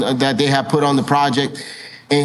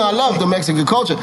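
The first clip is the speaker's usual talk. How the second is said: ordinary talk, nothing marked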